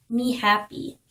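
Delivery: normal talking voice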